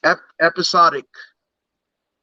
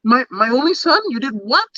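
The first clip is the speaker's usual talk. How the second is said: a higher, indignant God demanding answers; a mock-outraged deity, voice raised; playing God; 5 semitones higher than usual. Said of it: "He's acting like God".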